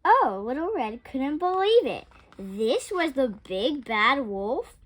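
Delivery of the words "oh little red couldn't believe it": ordinary delivery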